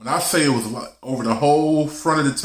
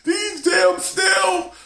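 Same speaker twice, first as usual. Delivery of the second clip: very high, slow shouted snails; dad yelling from the other room; mock dad shout; much slower than usual. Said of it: "My dad was yelling".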